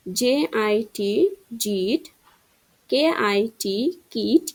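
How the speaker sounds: slow spelled letters, then the word; spelling drill. Shown as a demonstration. Teacher spells words for pupils to repeat, slowly. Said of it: "আমি যেরকমভাবে বলতেছি".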